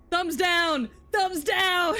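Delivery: high, loud mock-outraged jeer; booing shout. Real, not acted. Streamer jeering all Diplin players to chat, high loud shout. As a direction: shout it like a one-person booing crowd.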